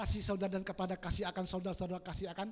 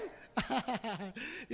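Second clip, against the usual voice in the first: rolling chuckle; continuing laugh. Real, not acted. Preacher after his own joke, continuing laugh.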